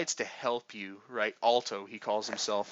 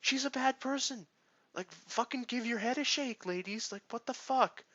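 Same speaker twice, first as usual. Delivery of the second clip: much higher, whiny mock wail of the upset girls; mocking the heroines; 13 semitones higher than usual. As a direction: squeak it out like silly girls grieving a villain. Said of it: "They're like".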